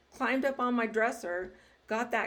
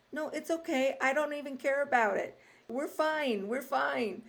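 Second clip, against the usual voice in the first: reassuring big sister; 5 semitones higher than usual. Retold or imitated. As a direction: soothe a sobbing little sister. Comforting crying little sister.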